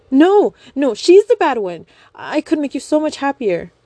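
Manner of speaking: high, loud frantic denial; desperate begging cry; loud high desperate plea. Acted. Young woman desperate plea.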